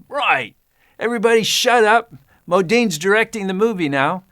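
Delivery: retold furious outburst from the director; angry director shout. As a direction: bark it at the whole crew to shut up. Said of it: "And he got so angry".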